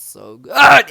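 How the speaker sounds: very loud, high, drawn-out praise; gushing rave. Real, not acted. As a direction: belt it out in delight. Gushing about the onions.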